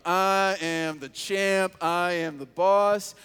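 slow, loud swaggering boast; cocky champ. Pretend. Elijah feeling unstoppable after winning, slow loud boast. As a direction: strut it out like a winner. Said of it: "You're feeling like".

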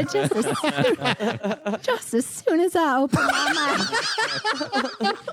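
Southern belle, breaking into laughter; put-on Southern accent through giggles. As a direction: try the Southern voice while laughing. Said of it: "This is [name]'s only character voice".

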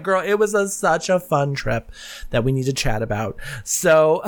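ordinary talk

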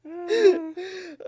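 high, loud burst of laughing; loud laughter. Real, not acted. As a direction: lose it completely. Laughing fit during intro.

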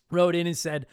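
ordinary talk

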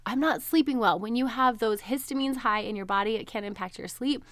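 normal talking voice

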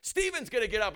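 ordinary talk

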